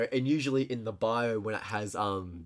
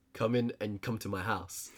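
low-key, softer mock Romanian accent; attempted Romanian accent; 4 semitones lower, 7 dB quieter than usual. Imitating the seller inviting buyers home, in a mock Romanian accent. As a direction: have a clumsy go at a Romanian accent. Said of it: "I don't know how to do the accent".

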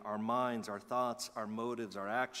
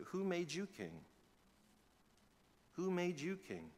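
higher, quieter, defiant challenge; defiant attitude; 5 semitones higher, 4 dB quieter than usual. Shown as a demonstration. Believer defiantly challenging sin with attitude. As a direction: toss it back at sin with attitude. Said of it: "Say that with an attitude".

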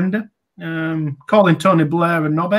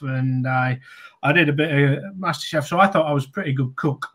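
normal talking voice

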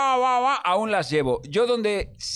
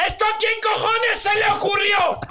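furious shout. Retold or imitated. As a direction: yell it like a furious parent. Retelling his rant at ride.

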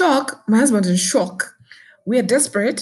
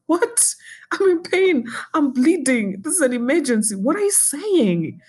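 much higher, pleading and indignant; desperate protest; 9 semitones higher than usual. Retold or imitated. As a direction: cry out that it's an emergency. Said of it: "I was like".

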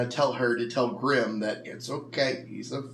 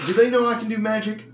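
excited in-character quip; 11 semitones higher, 8 dB louder, faster than usual. Retold or imitated. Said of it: "I was actually gonna be like".

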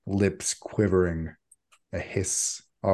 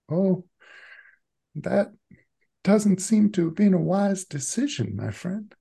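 much higher mild voice; Sun's gentle voice; 11 semitones higher than usual. Acted. Sun scolds Erica from behind in a much higher voice. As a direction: speak as a mild, polite figure.

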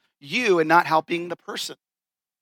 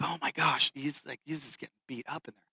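much softer, trailing away; hushed aside; 3 semitones lower, 13 dB quieter than usual. Retold or imitated. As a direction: murmur it aside to the guys. Friends watching buddy get yelled at.

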